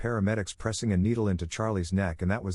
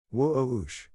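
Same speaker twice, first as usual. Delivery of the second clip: whoosh sound effect; 4 semitones higher than usual. Acted. Joke flying over head.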